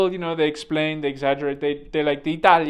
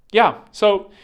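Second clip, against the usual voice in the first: usual speaking voice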